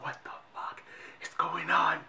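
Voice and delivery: hushed unvoiced whisper behind the door. Hiding behind the door, whispering in panic. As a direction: whisper it so nobody outside hears.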